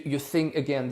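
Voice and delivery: normal talking voice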